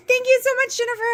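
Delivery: high, thrilled thank you; overjoyed thanks. Real, not acted. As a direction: gush your thanks. Thanking friend for gift.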